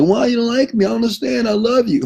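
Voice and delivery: high, pleading mock wail; mock crying. Rejected lover begging the one leaving, mock crying. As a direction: plead in a hammed-up, tearful high voice. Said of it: "you're crying".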